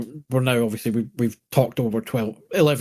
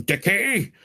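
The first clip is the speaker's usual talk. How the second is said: big booming call of a god; 9 semitones higher than usual. Retold or imitated. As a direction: boom it out, high and huge. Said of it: "the booming voice going".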